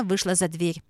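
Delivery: usual speaking voice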